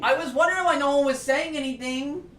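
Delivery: very high, indignant whine; sounding angry. Real, not acted. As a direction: reproach your partner in a high pitch. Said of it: "Y'all sure sound angry".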